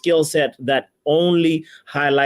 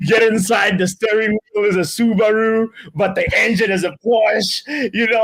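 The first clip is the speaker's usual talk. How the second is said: excited; 6 semitones higher than usual. Real, not acted. Excited car description.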